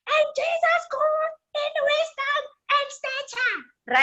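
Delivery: put-on high, slow sing-song of small children reciting a verse. Speaker mimics small children reciting their Bible verse. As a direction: chant it like a grade R class.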